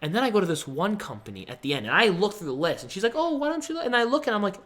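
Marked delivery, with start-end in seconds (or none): 3.1-3.9 high, light imitated friend's voice; encouraging suggestion. Friend urging speaker to look at the list, high imitated encouragement. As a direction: quote a friend nudging, pitched way up.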